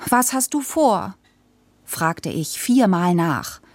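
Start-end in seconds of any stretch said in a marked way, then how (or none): 0.1-1.2 put-on higher-pitched child pressing Dad; insistent question. A narrator's reading. (drängend nachfragen, mit hoher Stimme)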